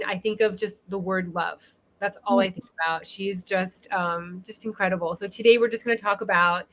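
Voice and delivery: usual speaking voice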